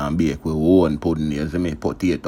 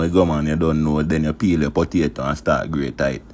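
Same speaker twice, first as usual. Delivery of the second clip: ordinary talk, nothing marked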